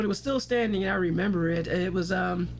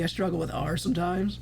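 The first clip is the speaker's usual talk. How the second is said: usual speaking voice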